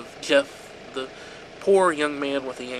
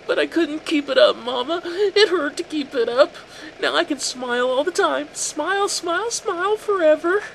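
put-on high, loud, eerie childish voice; unhinged sweet voice; 14 semitones higher, 5 dB louder than usual. A narrator's reading. A son showing his mother his cut smile, high and unhinged. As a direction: sing the smiles like a lullaby gone wrong.

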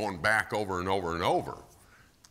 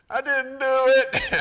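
put-on little child; 12 dB louder than usual. Child admits disobeying to dad.

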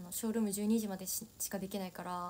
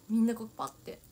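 ordinary talk, nothing marked

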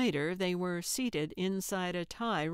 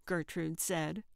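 ordinary delivery

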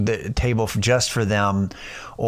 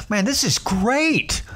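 much higher, gushing mock wonder; floored amazement; 10 semitones higher than usual. Tourist riding the New York subway, floored and gushing. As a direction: act out wide-eyed tourist delight. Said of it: "I was just floored".

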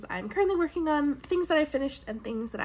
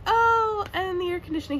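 sudden surprise; 6 semitones higher, 4 dB louder than usual. Real, not acted. Notices air conditioning turned on.

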